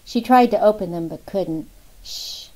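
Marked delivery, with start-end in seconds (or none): 2.0-2.5 hushed, soft shh; calm her with a gentle shush; soothing a hurt friend, soft shush. A narrator's reading. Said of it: "Thomas said".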